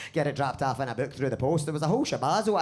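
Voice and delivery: usual speaking voice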